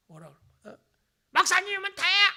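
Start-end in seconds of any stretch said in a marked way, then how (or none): 1.3-2.3 loud, high mock yell; angry shout. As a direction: yell it like an offended churchgoer. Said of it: "막 이러면서". (화난 교인을 흉내 내며 높고 크게 버럭 소리치기)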